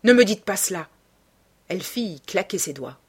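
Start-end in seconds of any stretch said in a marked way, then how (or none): 0.0-0.9 loud, forceful protest; raised-voice command to stop; angry shout. A narrator's reading. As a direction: shout her down. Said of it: "cria-t-il".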